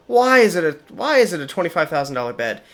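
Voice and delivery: high, loud disbelief, then rushing on; incredulous outburst. Real, not acted. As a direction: blurt it out in disbelief at the price. Shocked at the bed's price.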